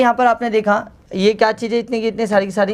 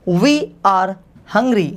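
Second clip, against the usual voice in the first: slow dictation; much slower than usual. Shown as a demonstration. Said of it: "मैंने बोला हम भूखे हैं".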